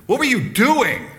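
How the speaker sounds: high, loud exasperated yell; mock yell. Coach yelling at a soccer player, hammed-up high shout. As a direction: blow up at the player in mock anger. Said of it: "That was a little different than".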